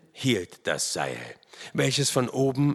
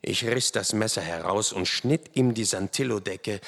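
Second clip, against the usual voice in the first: usual speaking voice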